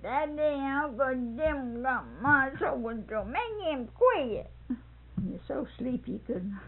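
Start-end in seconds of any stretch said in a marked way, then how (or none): 0.0-4.5 put-on high, drawn-out child's tattling; slow mock wail, rising higher at the end; little Monroe complaining. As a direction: imitate a small child appealing to Daddy. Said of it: "Monroe said".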